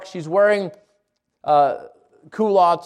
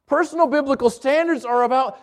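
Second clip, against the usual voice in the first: ordinary talk, nothing marked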